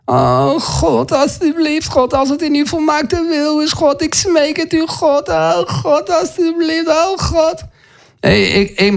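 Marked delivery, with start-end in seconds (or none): 0.0-7.6 high, whining imitation of begging God; mock pleading prayer. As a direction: beg piteously in a raised voice, hammed-up. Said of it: "We smeken, we bidden, we pleiten".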